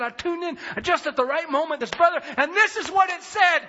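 high, rushing-to-tell excitement; excited. Retold or imitated. Husband tells his wife about the livestream, excited.

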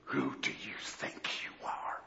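low muttered aside, nearly a whisper; whispered teasing scold; hushed mock retort. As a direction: sneer it under your breath. Voicing Jesus's hidden retort.

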